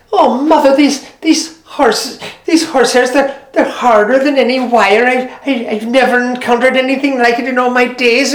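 lightly acted amazement; astonished daughter. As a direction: marvel at how hard the hairs are. Maureen struggling with hairs.

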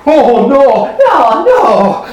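drawn-out chorus of denials; emphatic promise. Acted. Thieves promising Robin, emphatic drawn-out denial. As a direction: protest earnestly, swooping on each no.

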